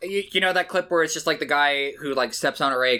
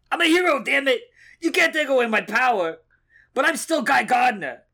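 shouting tough guy; 9 semitones higher than usual. Acted. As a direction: yell it like a wounded hero.

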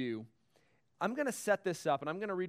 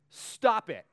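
a hint of a blunt therapist, louder; mock Bob Newhart; 4 semitones higher, 7 dB louder than usual. Retelling the Elf counseling scene, curt louder command. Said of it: "And he said".

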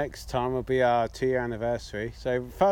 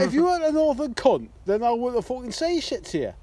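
high, louder mock snap in a northern accent; mock angry retort; 8 semitones higher, 3 dB louder than usual. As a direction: throw it back at her in jest. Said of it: "I was like".

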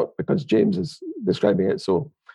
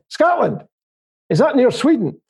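much higher mock puzzled barber; a baffled American barber, played up; imitating the barber; 7 semitones higher than usual. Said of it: "And he said".

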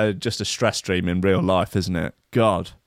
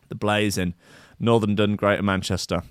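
ordinary delivery

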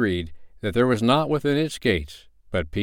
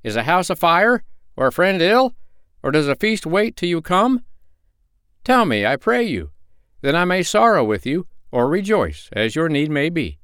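higher pitched, louder questioning; man's voice; 9 semitones higher, 3 dB louder than usual. Acted. Man questions the hurrying friends, high and loud. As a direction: press the travelers with eager questions. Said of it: "he cried".

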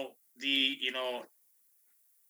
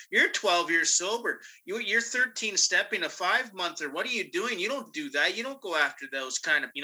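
judging outsiders; 6 semitones higher than usual. Retold or imitated. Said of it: "people would look at me and go".

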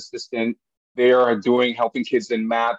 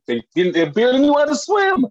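sudden high, loud burst of disbelief; exclaiming; 11 semitones higher, 6 dB louder than usual. Real, not acted. Dad telling the crowd his boys could barely swim, loud and high. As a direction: blurt it out, nearly laughing.

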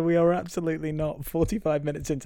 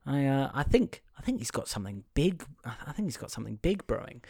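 quiet mumbling; 9 dB quieter, faster than usual. Retold or imitated. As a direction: muse it quietly like a hunch you keep to yourself. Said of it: "He's like".

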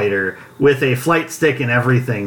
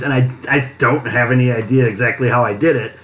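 ordinary talk

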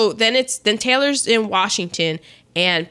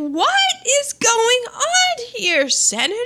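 indignant Smith. Retold or imitated. Acting out Smith confronting senator.

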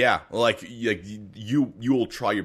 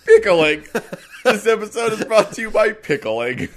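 mock sponsor read through laughter; laughing ad read; 10 semitones higher, 8 dB louder than usual. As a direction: announce the sponsor while laughing.

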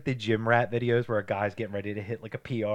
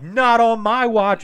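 loud, slow, drawn-out hero defiance; mock defiant shout; 9 semitones higher, 11 dB louder, much slower than usual. Friend voicing the X-Men to his buddy, slow mock shout. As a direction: bellow it like a cocky superhero.

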